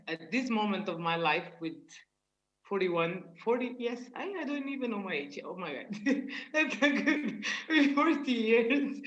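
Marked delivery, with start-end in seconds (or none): 6.0-9.0 higher and laughing through the words. Real, not acted. Guest laughing at forgetting her own age. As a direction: giggle out the line, slowed and louder.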